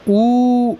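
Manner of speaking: ऊ held out over three beats, high and loud; prolonged pluta u. Shown as a demonstration. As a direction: stretch the ऊ long like a call. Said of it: "प्लुत वाले ऊ में लगता है". (प्लुत ऊ, तीन मात्रा तक खींचकर)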